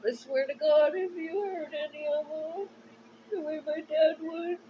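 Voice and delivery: loud, high, tear-choked threat; crying furious vow. Real, not acted. Warning anyone who hurts her animals, crying in anger. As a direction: spit the warning through sobs.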